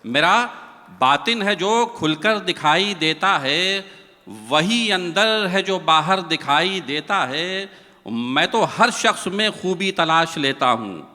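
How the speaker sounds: measured, lilting verse delivery; poem recitation. Acted. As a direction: recite it like a mushaira couplet.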